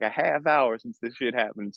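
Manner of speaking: ordinary talk, nothing marked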